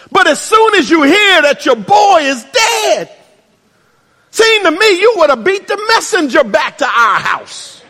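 Mary venting her grievance at Jesus; indignant sister Mary. Retold or imitated. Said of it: "a sister whose attitude is".

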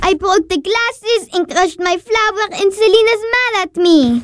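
high-pitched tearful confession; upset. Acted. Child runs to big sister after breaking glasses, upset and tearful. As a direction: pour out the trouble, close to tears. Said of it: "Once you calm down".

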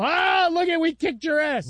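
high, loud gloating shout; hammed-up victory yell at a rival; mock gloating. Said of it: "not one that you go".

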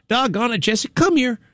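innocent, high-pitched call to a dog; Alex Murdaugh. Retold or imitated. He's talking to the dog, imitated innocent and benign. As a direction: imitate a benign owner calling the dog over. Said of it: "It was just like that. It was just that innocent".

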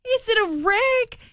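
high, incredulous sarcastic retort; mock exasperation. Host answering a driver's silly wreck question, mock exasperated. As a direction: throw the silly question back, pitched high.

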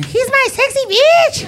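high, loud, drawn-out wife voice; wife's adoring squeal. Retold or imitated. Wife showing off her husband, high squealing impression. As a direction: squeal it proudly as his wife. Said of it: "His wife calls him Sexy".

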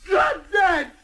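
high, angry shout of drop dead; furious yell. Acted. Truck driver screaming at the man who insulted him. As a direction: spit it at him. Driver yells back at rival.

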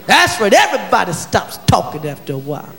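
normal talking voice

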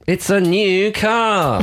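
slow, drawn-out prize reveal like a game show host. Pretend. Host unveiling a new car prize, slow and drawn out. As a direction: announce it like a Price is Right prize.

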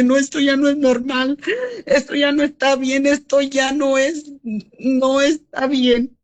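real tears breaking through the words; tearful. Real, not acted. Grieving mother telling the host her fear, near tears. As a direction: voice catching as she relives it, slowing down. Mother retelling daughter's death.

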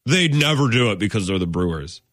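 mock-chesty sneer at the Brewers; chesty media mock. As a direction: puff up like a cocky pundit. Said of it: "get all chesty and be like".

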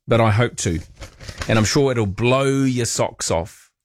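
usual speaking voice

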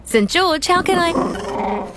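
bursting with excitement; overexcited, stretched out greeting; excited. Acted. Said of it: "gets a little excited".

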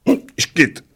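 high, spluttered mock hiccup; hiccup bursting out. A narrator's reading. Little old man with hiccups, high splutter. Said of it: "vyprskl maličký".

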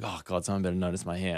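low, quick imitation of someone expecting notice; mock needy energy. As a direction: mutter it softly, imitating silent expectation. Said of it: "got that energy of like".

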